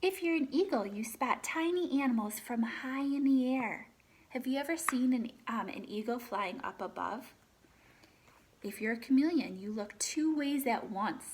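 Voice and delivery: lively read-aloud, animal names lifted; reading to children. Acted. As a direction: read the book to the class, naming each animal brightly. Teacher reads picture book aloud.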